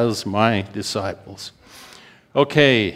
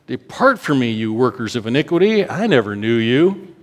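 pleading rebuke; 5 semitones higher, 3 dB louder than usual. Acted. Jesus asking Philip how he could not know him, aching. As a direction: let the hurt rise on the name.

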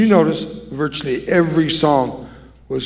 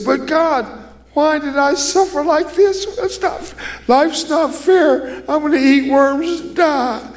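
high, squeaky mock whine of self-pity; whiny complainer; 12 semitones higher than usual. Preacher mocks a self-pitying believer, whining high. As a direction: whimper like a sulking child, hammed-up. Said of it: "you say".

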